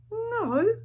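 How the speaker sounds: put-on high little-boy no; child's reply. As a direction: answer brightly like a small kid. Little boy answers pirate.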